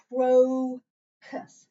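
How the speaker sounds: CROCUS stretched very slowly, in two separate syllables; drawn-out syllables. Shown as a demonstration. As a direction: sound out CROCUS loudly, holding the first syllable long. Teacher models the word for decoding.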